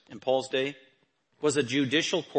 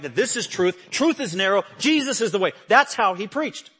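louder, climbing preacher's proclamation; preaching at full force; 4 semitones higher, 5 dB louder than usual. Acted. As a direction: hammer each claim home from the pulpit.

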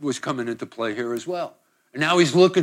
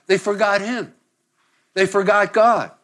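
usual speaking voice

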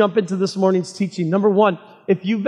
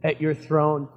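prayer. Acted. Pastor leads opening prayer.

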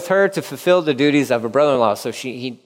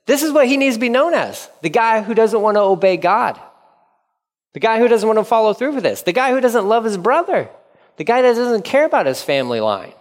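raised, pointed denunciation, pitched high; mock public shaming; 7 semitones higher than usual. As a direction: call him out before the town elders. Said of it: "and says".

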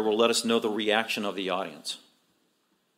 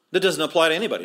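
usual speaking voice

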